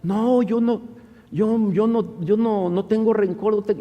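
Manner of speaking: high-pitched imitation of a crying woman; tearful denial. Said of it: "esta persona llora y llora". (negación llorosa imitada, en tono agudo)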